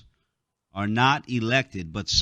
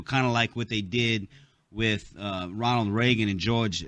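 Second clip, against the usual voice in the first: usual speaking voice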